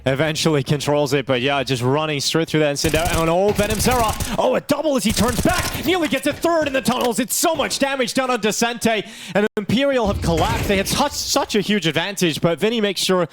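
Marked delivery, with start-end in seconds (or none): 3.2-11.6 excited play-by-play. Acted. Live play-by-play burst.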